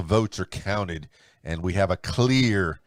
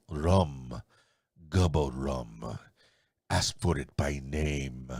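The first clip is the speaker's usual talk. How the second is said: put-on low, slow Spanish-accented rum commercial; 6 semitones lower, 4 dB quieter, much slower than usual. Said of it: "do it in my Spanish accent".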